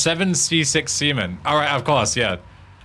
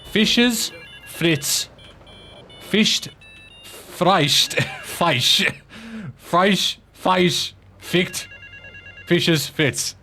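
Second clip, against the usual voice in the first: halting, higher-pitched German tongue-twister attempt; 4 semitones higher, much slower than usual. Shown as a demonstration. Streamer winging a tongue twister in unknown German, halting attempt. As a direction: fumble through the German, word by word. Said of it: "I have a German tongue twister".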